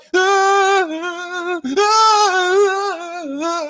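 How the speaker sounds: high, long hammed-up wail; mock sobbing. Host imitating dramatic water sign criers, mock sobbing wail. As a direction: bawl like an exaggerated crier. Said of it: "They be like".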